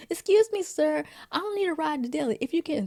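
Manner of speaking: high, mock-polite request; put-on polite woman. Willie asking a sir for a ride to Delhi, high mock-polite.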